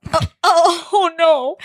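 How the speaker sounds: high, rising alarm in a quick oh oh oh no; sudden dread breaking through; dismayed oh no. Retold or imitated. Jim realizing Spock is waking up, dismayed.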